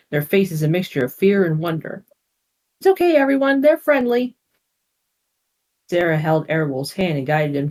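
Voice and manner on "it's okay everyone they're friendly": calling out. Acted. Sarah calms frightened family.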